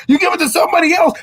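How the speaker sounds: high, fast yell at the coach; yelling at the coach to change the play; fired-up shout. Real, not acted. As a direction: shout it out, fed up. Fired-up sports rant.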